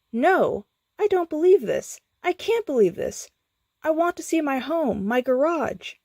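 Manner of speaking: high, distressed protest; reeling in disbelief. A narrator's reading. Skips refuses to believe.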